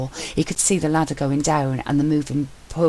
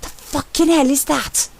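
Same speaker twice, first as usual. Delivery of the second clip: high, loud frightened shriek; panicked cry from the hidden room; 13 semitones higher, 5 dB louder, slower than usual. A narrator's reading. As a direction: scream at the thing in the dark. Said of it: "Sentry's shriek".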